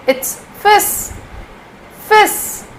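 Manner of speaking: fis said with no L, ending on a clear s; silent L in fils. Shown as a demonstration. Teacher showing the silent L, fis said slowly. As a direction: drop the L: say "fis". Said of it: "L is silent here".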